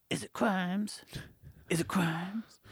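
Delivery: soft, secretive, lifted pitch; half-whispered teasing question; hushed excited question. Retold or imitated. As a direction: ask it very quietly to rile the dog. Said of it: "the higher pitch my voice gets".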